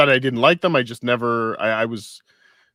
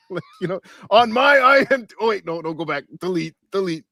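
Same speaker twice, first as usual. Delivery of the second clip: imitating him; 8 semitones higher, 4 dB louder than usual. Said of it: "had to go back and edit it".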